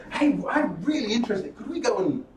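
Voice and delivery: high, bright-eyed keen voice; eager audience member. Retold or imitated. Audience member to speaker after the talk, eager and high. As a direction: play the keen listener who looked bored. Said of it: "they come up afterwards going".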